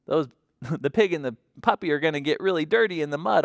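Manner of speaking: ordinary delivery, nothing marked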